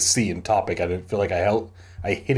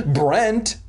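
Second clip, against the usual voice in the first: higher-pitched call of a name; 8 semitones higher than usual. A narrator's reading. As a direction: call out for someone missing. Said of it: "I called his name".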